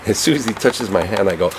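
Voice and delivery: normal talking voice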